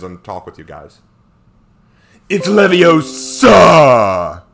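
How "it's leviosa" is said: loud, drawn-out shout, pitched up; shouted correction. Acted. Mimicking a film line, long loud shout. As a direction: belt it and stretch the last syllable.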